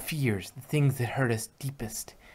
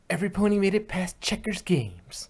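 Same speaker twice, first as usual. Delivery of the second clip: relieved cheer; 7 semitones higher, 4 dB louder than usual. Acted. Relieved cheer after victory.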